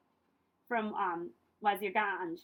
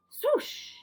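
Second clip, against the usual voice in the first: high, breathy swoosh; whooshing sound effect; 8 dB louder than usual. A narrator's reading. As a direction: blow the rock apart with a whoosh. Mountain breaks in the story.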